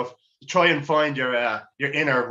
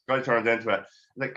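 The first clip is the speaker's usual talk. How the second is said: ordinary talk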